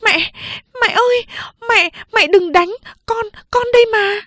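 high, frantic cry to mother in the dark; voice cracking in panic. Acted. Second sister begging mother not to strike, voice cracking. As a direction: cry out, voice breaking with fear. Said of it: "tiếng chị hai đã lạc cả giọng".

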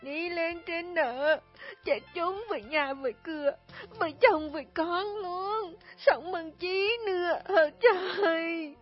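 high, slow, crying lament; despairing cry. Acted. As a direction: sob out hopelessness. Despairing widow answers crying.

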